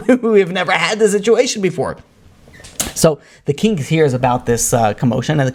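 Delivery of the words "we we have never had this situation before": stammering, high-pitched bewilderment; acted-out judge losing his grip; flustered judge. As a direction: sputter in raised-pitch disbelief. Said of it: "going out of his mind".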